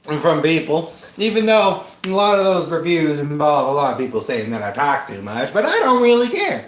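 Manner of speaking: chatty hillbilly rambling; folksy twang, rambling on. Acted. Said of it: "You like my hillbilly voice?".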